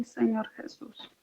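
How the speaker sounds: ordinary delivery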